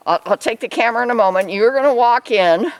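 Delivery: normal talking voice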